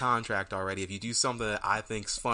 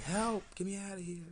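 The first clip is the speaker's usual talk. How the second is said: yelling; 10 semitones higher, 7 dB quieter than usual. Retold or imitated. Prisoner yelling.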